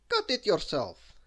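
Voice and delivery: defiant storybook comeback; Mister Cheeky. A narrator's reading. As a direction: answer back loudly. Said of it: "said Mister Cheeky".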